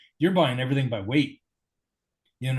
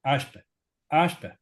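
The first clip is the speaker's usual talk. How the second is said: imitating Luigi's dialect; 2 semitones higher, 3 dB louder than usual. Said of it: "he goes to me and he says".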